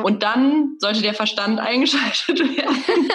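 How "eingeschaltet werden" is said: laughing. Real, not acted. Joke about switching on reason.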